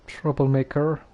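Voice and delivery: ordinary talk, nothing marked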